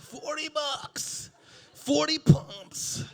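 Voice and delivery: high, slow, stretched punchline; slow drawn-out punchline. Acted. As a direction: draw out every word. Punchline slowed down.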